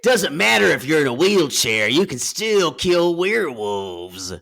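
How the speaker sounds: loud, drawling actor impression; Gary Busey. Co-host resuming the werewolf movie bit, loud celebrity impression. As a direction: declare it in character, stretching the ending. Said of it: "This is my Gary Busey".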